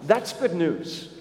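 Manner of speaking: ordinary talk, nothing marked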